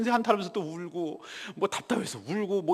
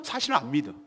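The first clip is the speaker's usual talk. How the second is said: ordinary talk, nothing marked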